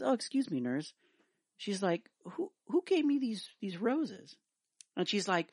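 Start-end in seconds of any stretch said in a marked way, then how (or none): 0.0-0.9 wife's voice. Acted. Wife voiced in a joke. 2.3-4.4 high, mannered wife asking the nurse; wife's voice. Acted. Woman asks the nurse who left the roses, high voice.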